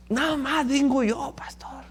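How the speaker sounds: hushed, slow whisper of a humble church sister; whispered plea. Retold or imitated. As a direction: whisper it as if embarrassed to cause the trip. Sister says only she comes.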